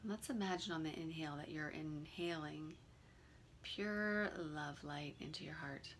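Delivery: calm, unhurried meditation guide with long pauses; guided meditation. Real, not acted. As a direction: gently lead them through the breathing, leaving room between phrases.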